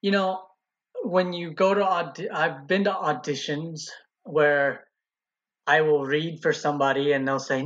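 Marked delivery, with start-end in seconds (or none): none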